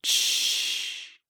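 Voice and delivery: soft unvoiced shush. A narrator's reading. Narrator hushing the listener, a quiet unvoiced shh. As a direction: hush the listener with a long shh.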